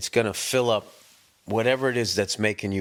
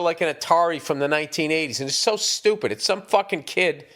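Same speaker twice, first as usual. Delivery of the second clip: normal talking voice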